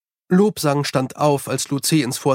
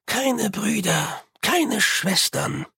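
sadly; 6 semitones higher, much slower than usual. A narrator's reading. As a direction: let each word sink in sadly. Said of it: "sagte Lu Tze traurig". (langsam, leise und traurig)

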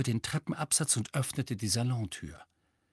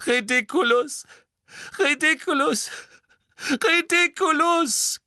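high, loud, rising desperate cries; desperate sobbing; 6 dB louder than usual. A narrator's reading. Mrs Weasley crying the spell at the boggart, desperate. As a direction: cry out the spell again and again.

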